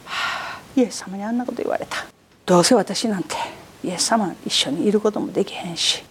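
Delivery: low, muttered self-pity with sighs; sulking. Retold or imitated. A believer sulking after Jesus called him Satan, low mock sulk. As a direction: mope like someone stung by a rebuke. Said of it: "すねる。".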